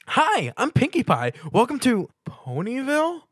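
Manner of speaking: high, loud chirpy pony voice; Pinkie Pie. A narrator's reading. Pinkie Pie introducing herself to Stuart, high and chirpy. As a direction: bounce through the greeting.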